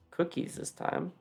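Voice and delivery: normal talking voice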